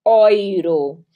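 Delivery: euro begun with an oy sound, drawn out; German pronunciation. Shown as a demonstration. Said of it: "E U se pronuncia oi". (euro dito "óiro", em alemão)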